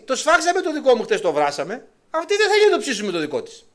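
high, loud indignant protest; mother protesting to the king, outraged and loud; outraged woman. Acted. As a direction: cry out at the injustice. Woman protesting broken agreement.

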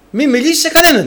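high, loud warning; stern warning. Pretend. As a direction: warn him firmly not to speak. God's stern instruction to prophet.

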